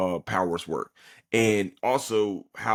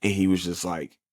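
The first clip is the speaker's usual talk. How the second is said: ordinary talk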